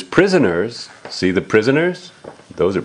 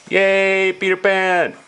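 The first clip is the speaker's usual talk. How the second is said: cheering; 8 semitones higher than usual. Acted. Cheering hero's arrival.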